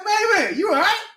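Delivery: high urgent call. Retold or imitated. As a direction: try to rouse him. Calling to sleeping driver.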